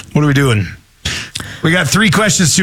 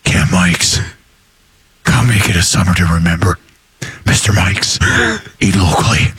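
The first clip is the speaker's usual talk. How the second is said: Batman voice; 10 semitones lower than usual. Acted. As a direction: read the camp ad like a dark-knight growl. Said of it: "But that's his Batman voice".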